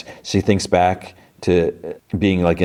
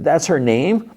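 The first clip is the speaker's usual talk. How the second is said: high-pitched incredulous question; mock indignant disbelief; 10 semitones higher, slower than usual. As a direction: shoot the pitch up in put-on disbelief. Indignant reaction to rival's name, retold.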